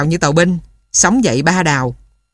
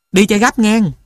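raised, higher voice calling after someone; called after; 5 semitones higher than usual. A narrator's reading. Old man calling after the runner to hurry, raised voice. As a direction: shout the order after him. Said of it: "dặn vói".

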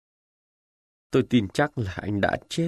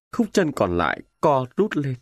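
usual speaking voice

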